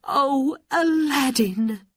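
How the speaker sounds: high, loud motherly exclamation; crying out. A narrator's reading. Mother greeting her returned son, crying out happily. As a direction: call out in relieved joy. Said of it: "she cried".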